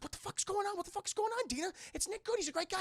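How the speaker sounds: high squeaky bewildered voice; high confused Josh. Retold or imitated. Josh confused as Dina drags him off, high-pitched. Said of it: "And Josh's like".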